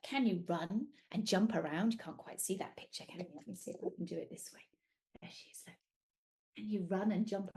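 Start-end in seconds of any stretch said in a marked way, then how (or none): 1.9-4.7 soft, fast, breathy murmur; quiet aside. Real, not acted. Storyteller fixing the picture, soft quick murmur. 5.2-5.8 hushed whisper. Real, not acted. Storyteller showing the picture, whispering.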